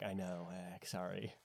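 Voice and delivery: soft, small-voiced sorry; retold sheepish apology. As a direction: back down quietly, mock apologetic. Acts out apology to confused friend.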